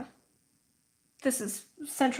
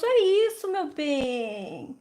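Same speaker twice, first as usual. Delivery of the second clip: higher, Brazilian-tinged fond uncle voice; doting uncle; 6 semitones higher than usual. Retold or imitated. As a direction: coo at the toddler like a delighted uncle. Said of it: "And my uncle goes".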